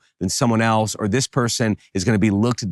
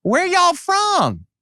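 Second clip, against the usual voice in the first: high, slow, sugary Southern drawl; very high-pitched Southern sweetness, drawn out; sweet Southern accent; much slower than usual. Acted. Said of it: "says in this really sweet accent".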